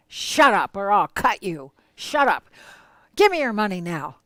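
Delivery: loud, menacing thug's threat; threatening robber. Retold or imitated. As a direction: bark it at the victims.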